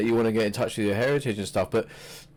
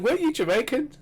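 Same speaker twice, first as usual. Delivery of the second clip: squeaky mock Triple H jibe; a teasing falsetto question; mock high-pitched quote; 14 semitones higher, faster than usual. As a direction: pitch it way up to poke fun. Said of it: "Like that".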